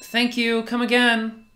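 high, bright shop-counter voice; cheery clerk; customer service voice. Acted. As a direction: chirp it like a cashier.

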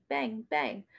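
plain reading voice

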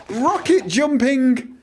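high, slow, drawn-out exclamation; stretched-out high triumphant call; excited announcing. Real, not acted. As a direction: announce the stunt with relish. Announcing game stunt.

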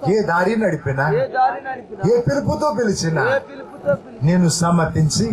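intense pleading prayer, rising on each plea; fervent prayer. Acted. Preacher prays over the congregation, fervent pleading prayer. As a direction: pour it out to Jesus, pleading.

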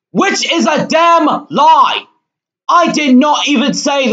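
outraged high shout of denial; indignant yelling; screaming. Real, not acted. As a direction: shout it loud and high, stretching the words in protest. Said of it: "Sorry if I was screaming".

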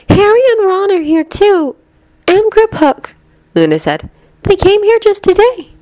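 put-on high-pitched girl's character voice; Luna, explaining. A narrator's reading.

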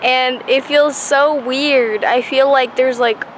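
metal brackets on teeth; talking with new braces. Real, not acted. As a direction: mouth adjusting to braces. Seen 0: brackets and wires clearly on teeth throughout; R4 shows bare teeth.

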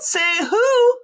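loud, high angry howl. A narrator's reading. Bully Mean Jean howling at Katie Sue, loud and high. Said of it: "she howled".

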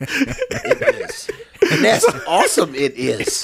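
laughing Yoda-style inverted phrasing; a light laughing hint of Yoda; Yoda speech. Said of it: "See, Yoda".

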